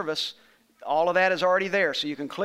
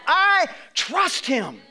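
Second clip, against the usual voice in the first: declaring trust; 6 semitones higher, much slower than usual. Acted. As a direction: shout out the believer's trust. Voicing the believer's cry.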